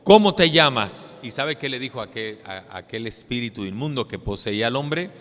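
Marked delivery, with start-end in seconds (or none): 0.0-1.2 stern question. Acted. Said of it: "le preguntó Jesús al demonio".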